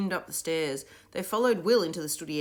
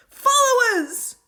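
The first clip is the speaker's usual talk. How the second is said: high, slow commanding shout; rally the crowd with one call; boy leader shouting to the children; cried out; 14 dB louder, much slower than usual. A narrator's reading. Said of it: "he cried".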